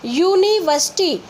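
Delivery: यूनिवर्सिटी slow, starting on a clear yoo glide; university with yoo. Shown as a demonstration. Teacher modelling university for pupils. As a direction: say यूनिवर्सिटी slowly, yoo at the front. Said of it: "आप इसको बोल के देखिए".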